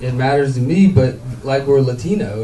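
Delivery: ordinary talk, nothing marked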